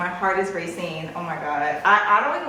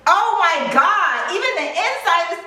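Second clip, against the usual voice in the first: amazed outburst; 10 semitones higher, 3 dB louder than usual. Real, not acted. Buyer seeing inside the bag, amazed outburst. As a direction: burst out high, stretching the exclamation.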